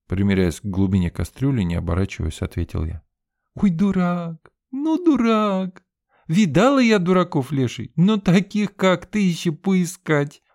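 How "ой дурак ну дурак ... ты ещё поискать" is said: high, drawn-out groan, half laughing; moaning through laughter. A narrator's reading. Sveta groaning and giggling at his joke. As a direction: groan at him, trying not to laugh. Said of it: "простонала Света".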